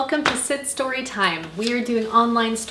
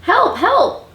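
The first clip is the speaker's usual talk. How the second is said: very high, loud call; crying for help; 11 semitones higher, 7 dB louder than usual. A narrator's reading. Blue is stuck tight in mud, high cry for help. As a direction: cry out like a little truck in trouble. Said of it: "cried the Little Blue Truck".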